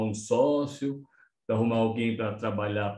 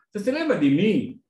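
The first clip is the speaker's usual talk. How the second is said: retold line, raised and loud; spoken loudly; 8 semitones higher, 5 dB louder than usual. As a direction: call it out for the whole shop to hear. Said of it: "Falei bem alto". (falando bem alto)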